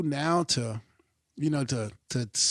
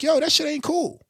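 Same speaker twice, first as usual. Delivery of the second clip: imitating someone calling it out; 12 semitones higher, 4 dB louder than usual. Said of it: "there's no one like".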